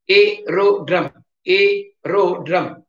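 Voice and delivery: AERODROME slowly split into syllables. Shown as a demonstration. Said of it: "split and write".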